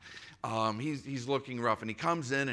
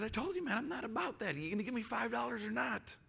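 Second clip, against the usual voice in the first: high, pushy voice, faster at the end; the hungry guy snapping back at the pastor, higher; irritated young guy; 11 semitones higher, 5 dB quieter, faster than usual. Retold or imitated. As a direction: play the guy losing patience. Homeless guy insists, irritated.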